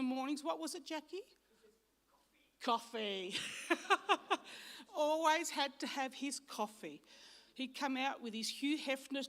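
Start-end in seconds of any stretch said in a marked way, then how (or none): 2.6-7.0 laughing loudly while speaking. Real, not acted. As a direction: laugh through the words. Laughing answer about coffee.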